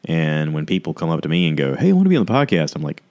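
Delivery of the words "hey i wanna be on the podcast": high, fast pushy fan; mock eager stranger. Stranger asking to be on his podcast, mock eager voice. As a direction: pitch it up like an over-keen podcast hopeful. Imitating people who approach him.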